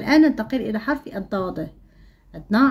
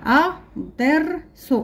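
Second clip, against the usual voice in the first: الدرس drawn out higher and slow; slow, raised; 6 semitones higher, slower than usual. Shown as a demonstration. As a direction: pull الدرس out slowly. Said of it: "لدينا الكلمات نقرأها".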